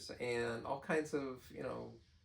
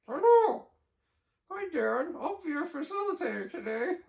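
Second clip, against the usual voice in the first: high squeaky puppet voice; ventriloquist voice; 8 dB louder than usual. Pretend. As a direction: lips barely moving, high and bright. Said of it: "I'll do my ventriloquist voice".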